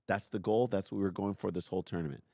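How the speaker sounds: ordinary delivery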